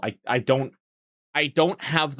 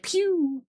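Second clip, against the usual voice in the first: mock pew sound. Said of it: "I could be like".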